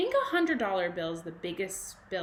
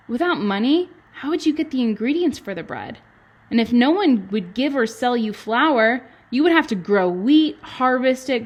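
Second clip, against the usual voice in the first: louder, lively storybook read with bouncing tune; reading aloud to children; 10 dB louder than usual. Acted. Teacher reading a money book to kindergarten, lively and loud. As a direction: read it out big and bright for little kids.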